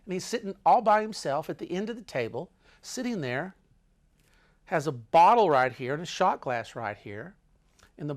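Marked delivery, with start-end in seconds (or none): none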